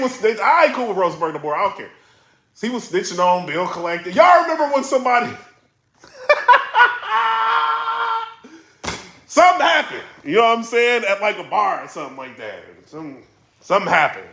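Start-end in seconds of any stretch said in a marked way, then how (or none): 6.2-8.3 shouting laugh. Real, not acted. Recalling the bar story.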